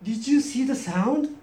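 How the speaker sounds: slow, high, mildly drawn-out question; imitating a local. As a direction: lightly voice a Seattle local asking. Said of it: "ある人が言うんですね".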